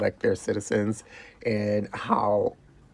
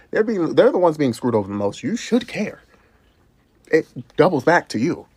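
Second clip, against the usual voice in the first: furious, high-pitched outcry; angry outburst; 6 semitones higher, 5 dB louder than usual. Real, not acted. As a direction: voice jumps high and loud in real fury. Angry rant at the government.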